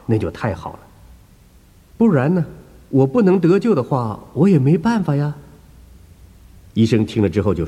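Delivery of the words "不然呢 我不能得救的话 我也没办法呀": raised, lightly voiced worried old woman; a higher pitched, fretful church lady; anxious old woman. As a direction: speak as the uneasy elderly believer. Woman patient speaking.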